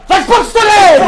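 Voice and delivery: high, loud protest; indignant yell. Real, not acted. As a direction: shout it at the friend in outrage. Reacts to being spat on.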